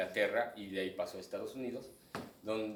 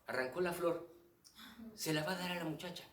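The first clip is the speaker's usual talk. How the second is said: put-on higher-pitched child spectator narrating; niños narrando pantomima; 6 semitones higher, 4 dB quieter than usual. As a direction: narrate what you see like a child. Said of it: "es ir narrando en voz alta". (niño que narra lo que ve, voz aguda)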